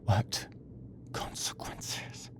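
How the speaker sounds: slow breathless whisper; terrified whisper. Acted. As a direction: whisper it in dread.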